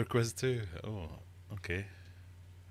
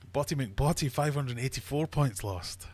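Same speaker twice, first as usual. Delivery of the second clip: usual speaking voice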